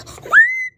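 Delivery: very high, loud squeaky gasp; high mock gasp. Kid mocking the Peppa Pig intro with a high squealing gasp. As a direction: gasp in a piggy cartoon way.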